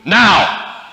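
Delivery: loud, sharp fatherly order; dad's command. Retold or imitated. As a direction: bark the word like a dad who means it. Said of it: "that one word that always gets us moving".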